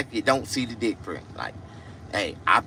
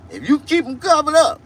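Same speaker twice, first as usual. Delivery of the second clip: squeaky plea; 7 dB louder than usual. Acted. Pleading punchline.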